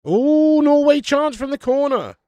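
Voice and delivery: loud thrilled live reaction; excited commentary. Real, not acted. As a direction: call the chance like a live commentator.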